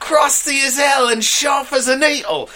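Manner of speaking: Ron's mum nagging about the mess, high shouted scold; scolding mother. Acted. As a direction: tear into him like a fed-up mum. Mother still scolding Ron.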